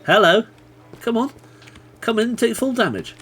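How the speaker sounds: high, bright sing-song taunt; teasing call. Real, not acted. Calling out teasingly to lure a mob. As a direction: coax it in playfully.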